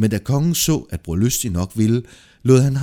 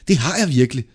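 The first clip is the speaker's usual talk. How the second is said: loud, higher, emphatic claim; keen confession, voice raised; eager insistence; 5 semitones higher, 5 dB louder, faster than usual. Acted. Eagerly insists to keep the gold.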